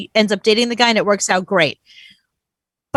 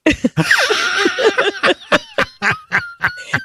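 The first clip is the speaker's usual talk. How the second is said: high burst of laughter; cackling laugh. Real, not acted. Laughs at own joke.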